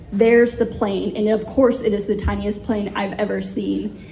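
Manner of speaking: ordinary talk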